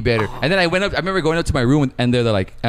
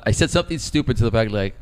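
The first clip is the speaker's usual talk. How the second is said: ordinary delivery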